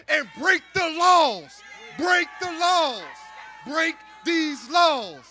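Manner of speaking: slow, loud rally chant; rally shout. Real, not acted. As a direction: drive it home to the crowd. Speech climax to crowd.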